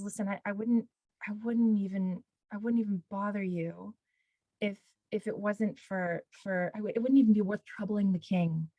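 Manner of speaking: soft, hesitant appeal to a ruler; humble plea. Retold or imitated. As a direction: hold back, apologetic for troubling him. Esther's humble appeal, acted.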